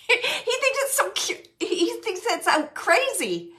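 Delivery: high-pitched, giggly delivery; laughing through words. Real, not acted. Relaying that he is laughing.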